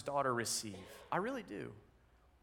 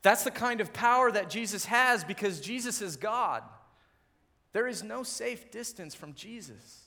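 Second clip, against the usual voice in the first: emphatic preaching; 7 semitones higher, 6 dB louder than usual. Real, not acted.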